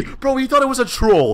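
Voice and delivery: normal talking voice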